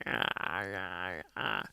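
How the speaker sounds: low, soft jaw-stuck aahs; groggy half-yawned ah ah ah; stifled yawn sounds. Retold or imitated. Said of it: "as I'm talking".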